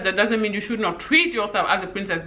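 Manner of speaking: ordinary delivery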